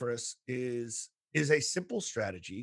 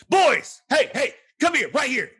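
put-on much higher, much louder call to the boys; raised stern voice; 10 semitones higher, 9 dB louder than usual. Counselor calling misbehaving boys over, loud stern shout. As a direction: bark it across the hall like a high school counselor. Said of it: "raising my voice like that".

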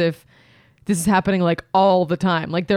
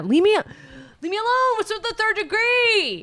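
annoyed partner; 13 semitones higher than usual. Retold or imitated. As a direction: play the hassled partner hammed-up.

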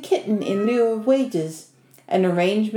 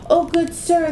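high, pleading call; crying snake woman; 9 semitones higher than usual. A narrator's reading. Snake begging Ivan to free her, high plea. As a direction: plead with the stranger for rescue. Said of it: "the snake cried".

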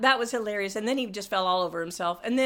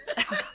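laugh; 3 dB quieter than usual. Real, not acted. Laughing mid-complaint.

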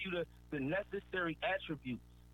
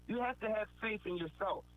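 You have to have faith in yourself, said higher, stressing have; emphasis on faith; 5 semitones higher than usual. Real, not acted. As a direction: press hard on have and faith. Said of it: "I'm saying this with emphasis".

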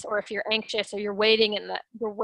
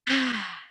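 releasing sigh; 2 semitones higher than usual. Shown as a demonstration. Coach showing a pre-call breath, gentle sigh. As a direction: breathe out the tension. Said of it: "let's just start with the breath".

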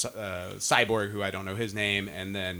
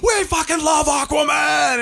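much higher, louder and slow chanted crowd; imitated crowd shout; 12 semitones higher, 6 dB louder, slower than usual. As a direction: yell it as an adoring crowd. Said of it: "people are like".

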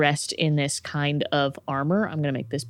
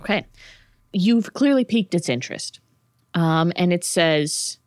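ordinary delivery

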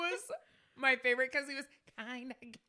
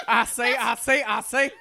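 loud, laughing rooster-cartoon voice; Foghorn Leghorn impression; 12 dB louder than usual. Teasing her friend, imitating a cartoon rooster, laughing and loud. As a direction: bark it out like the big cartoon rooster. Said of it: "Foghorn Leghorn?".

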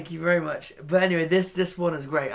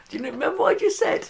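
smug and pleased, pitched up; 10 semitones higher, 5 dB louder than usual. Retold or imitated. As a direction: gloat like you told them so. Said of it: "You go through all smug".

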